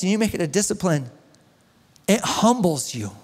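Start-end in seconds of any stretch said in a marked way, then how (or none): none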